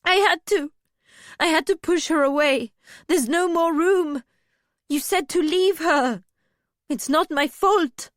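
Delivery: high, loud protesting excuses; defensive. A narrator's reading. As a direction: insist you are not to blame. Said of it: "Alaria sounded more defensive than sorry".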